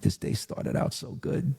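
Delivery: high, hushed, mock-cheerful aside; sarcastic muttering. Alone in the car, ruefully mocking his good day. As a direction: sigh it out with dry irony.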